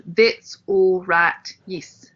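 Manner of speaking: slow South African that's all right, yes; slow, careful South African demo; South African accent. Shown as a demonstration. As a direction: drag the vowels South African style. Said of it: "changed to something different for South Africa".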